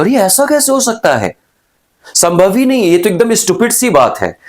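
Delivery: high, startled gasp of disbelief; shocked disbelief. Acted. Teacher acting a shocked person in disbelief. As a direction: act out being stunned by something absurd. Acted shocked reaction to surprise.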